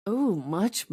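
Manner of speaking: usual speaking voice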